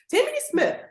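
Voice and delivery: high-pitched teacher's call-out; scolding full-name emphasis. Shown as a demonstration. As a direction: call out a child in trouble. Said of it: "say the first and last name for that emphasis".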